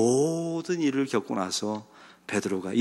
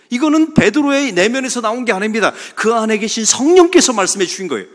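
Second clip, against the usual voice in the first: louder, higher sermon climax; forceful preaching; 7 semitones higher, 7 dB louder than usual. Acted. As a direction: preach it hard to the congregation.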